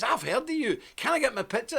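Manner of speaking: high-pitched imitation of a thrilled fan; starstruck excitement. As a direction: mimic her gushing as she recognises someone. Woman thrilled on recognising him.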